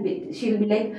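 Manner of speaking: ordinary talk, nothing marked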